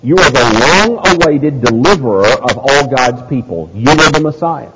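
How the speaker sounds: building, ringing proclamation; emphatic preached declaration; emphatic confession. Acted. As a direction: declare it with full preacher's conviction, pitch lifting. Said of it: "never more emphatic than this time".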